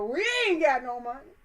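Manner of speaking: loud, high playful quote; repeating the catchphrase. Retold or imitated. Echoing Randy's line for fun, loud and playful. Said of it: "I like the way he say that".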